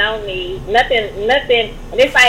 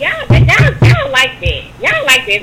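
excited; 3 dB louder, slower than usual. Real, not acted. Excited emphatic claim.

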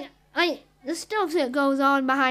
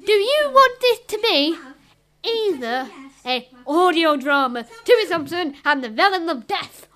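ordinary talk, nothing marked

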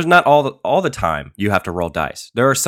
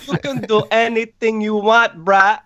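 high, laughing Jamaican druggie voice; a strung-out Jamaican character, pitched way up; 12 semitones higher, 3 dB louder than usual. Acted. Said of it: "Your character is Jamaican".

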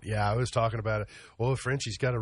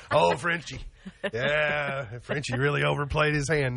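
mock expert; 5 semitones higher than usual. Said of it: "now I'm like an expert".

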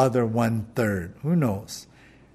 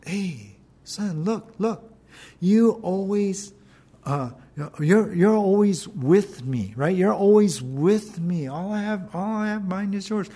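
tender plea; 3 semitones higher than usual. Acted. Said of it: "Jesus looking into the eyes".

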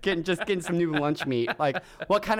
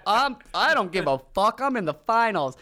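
put-on cocky cool kid; 7 semitones higher, 5 dB louder than usual. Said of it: "the cool kids were just".

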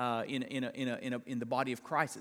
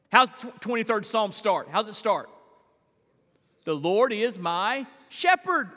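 loud, raised psalm recitation; full-force preaching; 6 semitones higher, 6 dB louder than usual. Acted. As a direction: declaim the psalm to the congregation.

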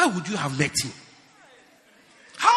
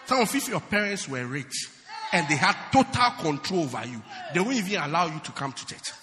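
ordinary delivery

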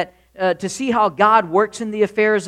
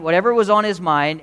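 ordinary talk, nothing marked

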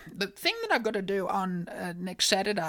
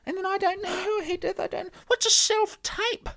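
high-pitched, wailing bewilderment; tearful panic; 12 semitones higher than usual. Acted. Telling her co-host she has no idea what's wanted, tearful panic. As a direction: wail it helplessly, nearly crying. Comic meltdown over audition task.

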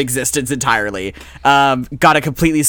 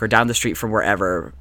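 ordinary delivery

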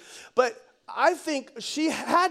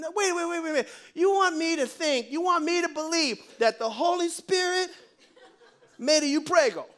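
put-on high, incredulous Italian-American boyfriend; Joey, Italian-style; 7 semitones higher than usual. Joey doubts Mo's Holy Spirit explanation, comic Italian disbelief. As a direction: question it in hammed-up disbelief.

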